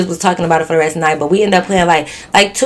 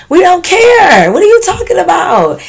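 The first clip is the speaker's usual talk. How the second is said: exasperated retort; 11 semitones higher, 5 dB louder than usual. Retold or imitated. Clapping back at the weirdo, exasperated shout. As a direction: holler it in high-pitched disbelief.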